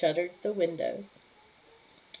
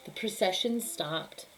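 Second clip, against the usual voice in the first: ordinary delivery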